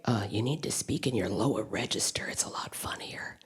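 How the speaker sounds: hushed, unvoiced producer's note; whispered note. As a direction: whisper the advice confidentially. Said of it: "come up to me constantly and say".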